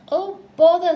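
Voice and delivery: high, loud cry of dismay; exasperated. A narrator's reading. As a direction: burst out in frustration at being stuck. Pooh stuck, dismayed.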